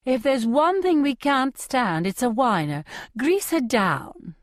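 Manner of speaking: hint of Capitol stylist disdain; mildly sing-song Capitol accent. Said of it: "some guy named Flavius".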